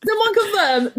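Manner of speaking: high, squealing laugh through the words; helpless laughter. Real, not acted. Co-host denying she cheated from comments, real helpless laughter. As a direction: protest through real giggles, pitch shooting up.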